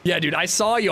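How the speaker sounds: ordinary talk, nothing marked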